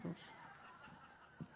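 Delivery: whispered curse with a laugh; panicked whisper. Retold or imitated. Comic panicking on the fire escape, whispering. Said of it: "I was like".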